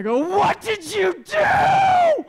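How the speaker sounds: long, high, full-voiced yell; furious shout. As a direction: scream it drawn out as an accusation. Acted storming in at roommate.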